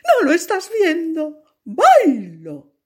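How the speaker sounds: high, loud and bright stranger's voice; a merry dancer showing off; cheerful dancer. Acted. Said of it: "el extraño personaje respondió".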